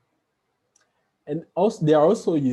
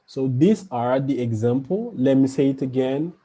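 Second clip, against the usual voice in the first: ordinary talk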